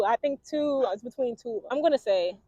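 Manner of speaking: ordinary talk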